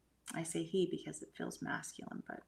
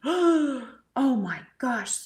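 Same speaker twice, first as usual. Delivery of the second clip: drawn-out, much higher and louder "Oh my gosh"; shocked gasp; 6 semitones higher, 11 dB louder, much slower than usual. Retold or imitated. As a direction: wake up gasping in shock.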